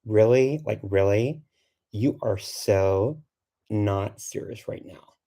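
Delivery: put-on drawn-out Valley Girl with rising questions; goofy, slow Valley Girl incredulity; Valley Girl accent. Shown as a demonstration. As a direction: stretch it out like a mock Valley Girl. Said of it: "It's my kind of Valley Girl".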